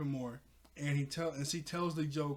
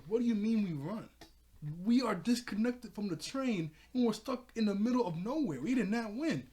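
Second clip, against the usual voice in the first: raised, high-pitched scolding; exasperated retort; 6 semitones higher, 3 dB louder than usual. Retold or imitated. Raven correcting the celebrating kids, exasperated. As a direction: set them straight, indignant.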